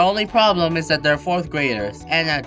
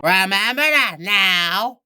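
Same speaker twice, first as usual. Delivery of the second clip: slow, higher, drawn-out repeat; slow and exaggerated, pitched up; emphatic reminder; 3 semitones higher, slower than usual. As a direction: stretch every word to drill it in.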